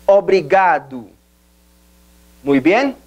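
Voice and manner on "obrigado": obrigado said slowly, o-bri-GA-du; pronouncing obrigado. Shown as a demonstration. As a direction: model obrigado for repeating. (pronunciación lenta de obrigado)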